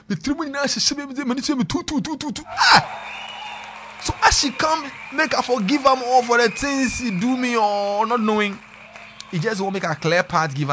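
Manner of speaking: high, loud, worked-up storytelling; excited retelling. Retold or imitated. Telling friends about the breakup, high and loud. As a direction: cry it out in mock outrage.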